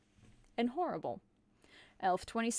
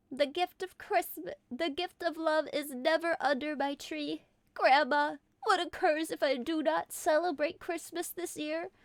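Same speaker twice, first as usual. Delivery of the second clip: lovelorn Carol voice; 5 semitones higher than usual. Acted.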